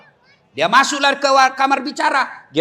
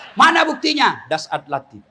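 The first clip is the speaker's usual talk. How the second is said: normal talking voice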